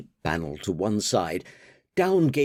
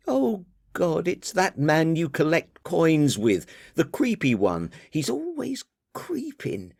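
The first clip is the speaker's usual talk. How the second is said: higher, exasperated daughter voice; 8 semitones higher than usual. Acted. Daughter chiding her father about the party, higher voice. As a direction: scold Dad for never listening, higher.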